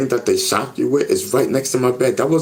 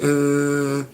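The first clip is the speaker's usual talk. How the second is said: imitated buzz; 7 semitones higher than usual. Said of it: "I hit".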